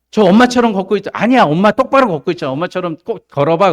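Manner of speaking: loud, high back-and-forth; crab family squabble. Acted. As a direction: act out mother and child bickering. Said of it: "개 엄마가 그런 거예요".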